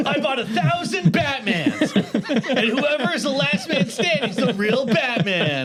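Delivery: high, slow, hyped-up host impression; stretched-out, high-pitched contest hype; Mister Beast. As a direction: proclaim it like an over-the-top stunt host. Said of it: "like Mister Beast".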